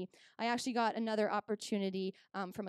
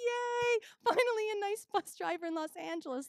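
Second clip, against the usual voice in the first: high, loud, delighted squeal; thrilled cheer at finally meeting a nice driver; excited squeal; 10 semitones higher, 4 dB louder than usual. Retold or imitated. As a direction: squeal with glee. Excited self quote.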